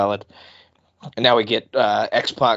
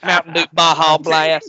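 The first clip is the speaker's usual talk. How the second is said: slow, loud southern drawl ordering food; southern accent; 5 semitones higher, 8 dB louder than usual. Retold or imitated. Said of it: "your Taco Bell ordering voice".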